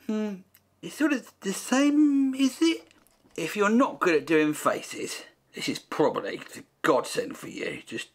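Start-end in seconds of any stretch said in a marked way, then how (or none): 0.1-2.9 a high, hammed-up Belgian accent; squeaky mock Belgian voice. Painter answering his own accent question in mock Belgian. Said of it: "Can you do Belgian accent?".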